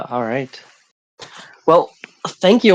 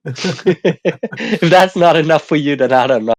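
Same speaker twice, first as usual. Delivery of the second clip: laughing; 3 dB louder than usual. Real, not acted. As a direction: laugh while repeating the joke. Joke repeated while laughing.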